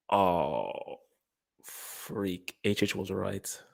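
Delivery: low whispered "H H was right"; imitating a defeated fan. Mocking fans, a low defeated whisper. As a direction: breathe it out like a beaten rival. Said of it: "you'll be like".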